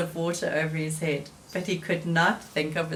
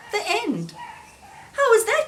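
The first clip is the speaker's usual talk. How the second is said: high Frog voice; 13 semitones higher than usual. Acted. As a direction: pitch it up high as a proud Frog. Frog voiced in read-aloud story.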